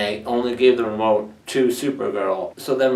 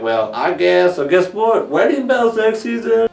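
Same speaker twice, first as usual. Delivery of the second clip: starts casual, climbs to a high cheer; hammed-up announcement, rising to the end; building mock glee; 7 semitones higher, 3 dB louder than usual. Retold proposal accepted, building glee.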